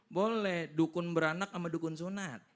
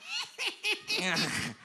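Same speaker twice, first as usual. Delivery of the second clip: high-pitched staccato spooky cackle; spirit's cackle; 13 semitones higher than usual. Acted. Shaman's summoned jinn makes its sound, high spooky cackle. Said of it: "Suaranya begitu".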